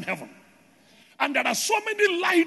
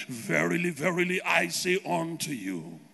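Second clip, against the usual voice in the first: very low, slow, solemn divine voice; imagined voice of God; 8 semitones lower, 4 dB quieter, slower than usual. Acted. Imagined God addressing a waiting believer, deep solemn divine voice. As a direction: intone it deep and drawn out, mock-holy. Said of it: "waiting to hear a voice telling you".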